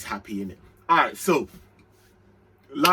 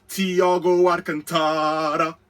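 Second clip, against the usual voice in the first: Thiago Alcantara drawn out slowly, each syllable savoured; slow name call; 3 semitones higher, 4 dB louder, slower than usual. Acted. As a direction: announce the name like a big signing. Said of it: "Man, I say Thiago".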